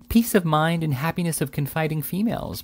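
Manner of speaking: ordinary delivery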